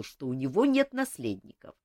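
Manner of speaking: ordinary delivery, nothing marked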